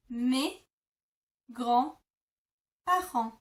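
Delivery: mes grands-parents stretched very slowly; slow teaching demo; slow syllable demo. Shown as a demonstration. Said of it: "to say my grandparents".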